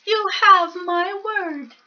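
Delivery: a tiny mouse politely angling for tea; polite little mouse. Acted. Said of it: "said the mouse".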